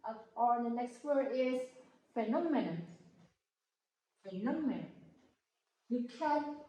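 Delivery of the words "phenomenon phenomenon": "phenomenon" with NOM raised, then falling away; teacher's demo of "phenomenon", stressed on NOM; second-syllable stress. Shown as a demonstration. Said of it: "add more stress in the second syllables".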